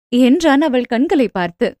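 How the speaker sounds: ordinary delivery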